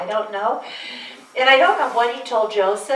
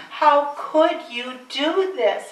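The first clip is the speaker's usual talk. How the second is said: slow, higher, wounded question; hurt father; 6 semitones higher, 3 dB louder, much slower than usual. Retold or imitated. Father wounded by his daughter, slow and pained. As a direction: ask it as a man who is deeply hurt.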